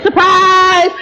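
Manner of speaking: playful surprise-party yell; party surprise shout. Retold or imitated. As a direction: jump out yelling surprise. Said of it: "they jumped out and said".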